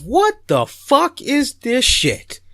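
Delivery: high, slow, appalled inner voice; disgusted disbelief. Retold or imitated. Guest facing peanut butter gravy, high appalled disbelief. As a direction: stare at the plate in high disbelief. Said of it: "I'm thinking to myself".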